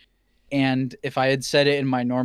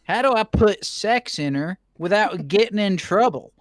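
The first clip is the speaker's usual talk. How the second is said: lightly higher Southern hillbilly twang; hillbilly accent; 6 semitones higher than usual. Acted. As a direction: play a dumb hillbilly asking. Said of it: "I made S B O a hillbilly".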